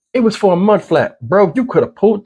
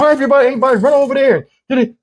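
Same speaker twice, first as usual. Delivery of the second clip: high, fast mocking voice of a confused onlooker; quick, high mock of people asking why; puzzled bystander; 7 semitones higher, much faster than usual. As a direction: put on a baffled outsider's voice and rush it. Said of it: "a lot of people are like".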